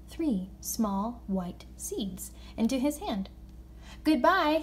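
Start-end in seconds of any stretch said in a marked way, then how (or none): 4.0-4.6 called-out farewell, raised and higher; lifted, louder parting call. A narrator's reading. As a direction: call a goodbye after someone leaving. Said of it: "he called after her".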